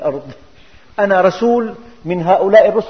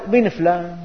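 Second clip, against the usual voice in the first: normal talking voice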